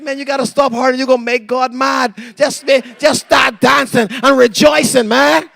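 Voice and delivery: high, loud preacher's holler; exhorting shout. Retold or imitated. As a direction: reenact urging someone to celebrate, building to a shout. Retelling urging weeping wife to rejoice.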